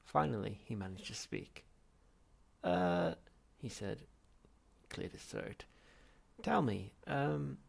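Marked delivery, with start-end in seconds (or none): 2.7-3.1 nervous uh. Acted. Nervous Arthur hesitating. 6.4-7.6 slow, hesitant nervous ask; halting, unsure of himself. Acted. Arthur nervously asking the others a question, halting. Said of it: "he said it so nervously".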